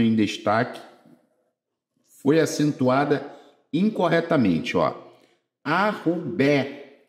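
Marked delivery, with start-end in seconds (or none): 5.6-7.0 Arrubé said slowly, landing on the final bé; slow word demo for students; stressed final syllable. Shown as a demonstration. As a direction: stretch out Arrubé and hit the last syllable bé. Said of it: "Arrubé é oxítona terminada em E".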